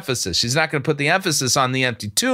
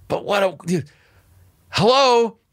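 exasperated hello; 5 semitones higher than usual. Real, not acted. Exasperated at the sermon.

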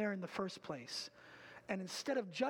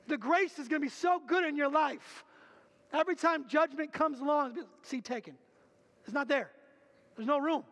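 louder, higher, driving sermon voice; full-force preaching; 5 semitones higher, 7 dB louder than usual. Acted. Pastor urges the congregation to confess, preaching hard. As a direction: preach it with fire to the room.

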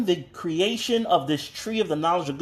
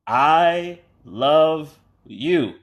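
very slow, stretched-out words; drawn-out declaration; 4 dB louder, much slower than usual. Retold or imitated. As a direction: stretch each word right out. Playing back his own taped message.

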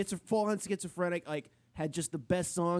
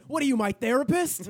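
Adam Sandler yell; 7 semitones higher, 6 dB louder than usual. Retold or imitated. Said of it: "Adam Sandler screaming like that".

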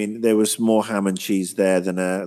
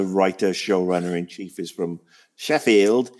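ordinary talk

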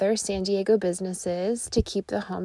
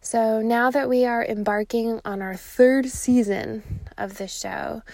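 ordinary talk

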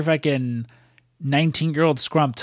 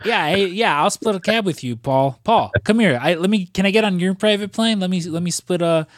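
higher-pitched, half-acted eager wheedling; mock pestering hanger-on; 6 semitones higher than usual. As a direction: badger Paul for a ride, lightly playacted. Playfully voices someone pestering Paul.

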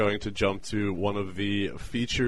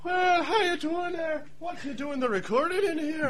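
character voice. Acted. S0 plays O'Neill entering.

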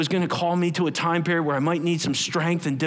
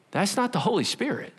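ordinary delivery

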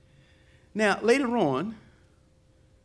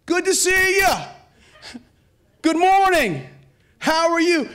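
higher, louder bright hello to neighbors; cheery greeting; 10 semitones higher, 5 dB louder than usual. Retold or imitated. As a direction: greet the neighbors with a big beaming voice.

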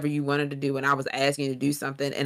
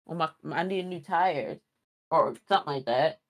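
usual speaking voice

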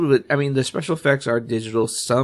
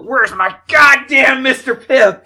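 high, loud, slow ranting demand; angry old mayor shout; 14 semitones higher, 11 dB louder, slower than usual. Retold or imitated. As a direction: rant about the soda. Quoting the mayor in cop car.

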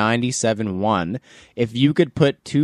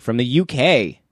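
ordinary delivery

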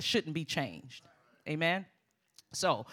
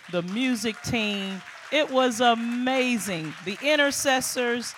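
excited praise; 6 semitones higher, 9 dB louder than usual. Real, not acted. Thanking volunteers, rising enthusiasm.

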